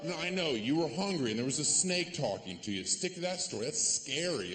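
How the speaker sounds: ordinary talk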